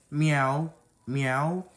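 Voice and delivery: kitten meow with a rising end; a light pretend cat meow; playful meow. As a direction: mew like a little kitten. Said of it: "Why are you meowing at me?".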